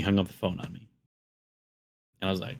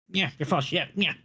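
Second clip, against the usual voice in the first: high, sneering mock of a rude customer; high mock of a rude caller; 10 semitones higher than usual. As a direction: throw it back like the snotty caller.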